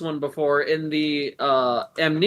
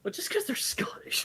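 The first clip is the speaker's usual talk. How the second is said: whisper; 12 semitones higher, 10 dB quieter than usual. Real, not acted. Unvoiced aside.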